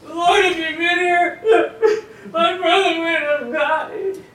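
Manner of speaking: high, loud crying plea, slowed; sobbing through the words to Jesus; weeping. Shown as a demonstration. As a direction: cry it out like a sister in tears. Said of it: "It would sound more like this".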